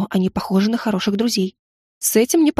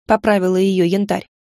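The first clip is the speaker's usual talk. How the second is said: ordinary delivery, nothing marked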